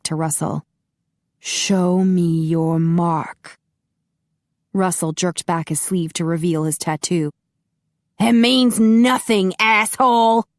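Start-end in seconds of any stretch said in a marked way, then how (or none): 1.4-3.6 very slow, muffled, insistent command; muffled demand. A narrator's reading. Master Han repeats demand. 8.2-10.5 loud, higher defiant yell; angry shout. A narrator's reading.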